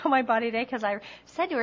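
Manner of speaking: ordinary delivery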